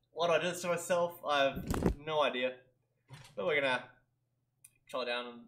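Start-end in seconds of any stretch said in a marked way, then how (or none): none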